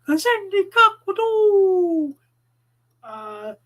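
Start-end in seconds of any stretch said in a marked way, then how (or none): 0.1-2.2 high drawn-out tagline. Acted. Ad read tagline.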